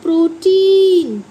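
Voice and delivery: put-on प्रोटीन loud, with a long drawn TEEN; emphatic pro-TEEEN for प्रोटीन; demonstrating pronunciation. Shown as a demonstration. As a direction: model प्रोटीन, stretching the ee. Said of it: "तो इसे हम बोलते हैं".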